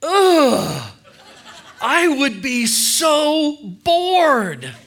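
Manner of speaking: high, loud, slow groaning whine of disgust; bored teller impression. Coworker scoffing at his hobby, high loud drawn-out whine. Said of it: "I'm gonna try to do, uh, the impression".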